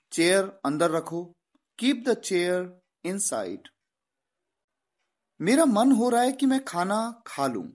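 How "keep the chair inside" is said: very slow, word by word; slow dictation English; very slow model sentence. Shown as a demonstration. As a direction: spell the sentence out slowly for students. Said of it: "teacher models English sentence".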